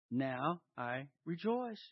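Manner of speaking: soft, low, slow and spaced out; quiet reflection. Shown as a demonstration. Preacher asks if this is your attitude, hushed. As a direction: let each word sink in quietly.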